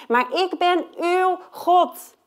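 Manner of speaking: stately divine voice; voice of God. Retold or imitated. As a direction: declare it slowly and grandly. God's words voiced.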